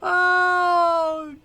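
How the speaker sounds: high, loud laughing cry of amazement; excited shouting. Real, not acted. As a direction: yell and laugh at the blast. The fusion warhead explodes.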